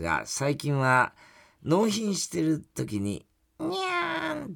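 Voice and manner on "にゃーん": high, slow little meow; cat meow. Retold or imitated. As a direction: purr it like a spoiled cat. Letter imitates cat meow. (甘えた猫の鳴き声)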